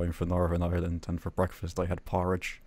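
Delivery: Northern Irish, not sing-songy; dreary Northern Irish monotone; depressing Northern Irish accent. Shown as a demonstration. Demonstrating Northern Irish for friends, depressing accent. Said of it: "depressing. That's the only way I could describe it".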